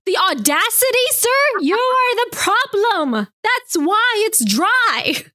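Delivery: high, slow, drawn-out outrage; mock indignation. Scolding a sir over a dry wedding, mock indignant.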